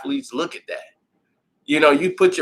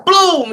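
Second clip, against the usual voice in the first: mock crash sound; 13 semitones higher, 7 dB louder than usual. Food thrown, sound effect.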